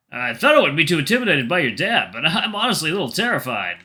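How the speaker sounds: put-on a touch of an intimidated boyfriend. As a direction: play the boyfriend admitting nerves.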